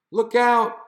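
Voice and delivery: loud, high alarm cry; calling out in alarm. A narrator's reading. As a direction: shout the warning high and loud. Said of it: "he called".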